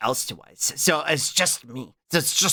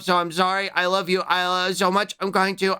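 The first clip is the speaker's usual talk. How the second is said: normal talking voice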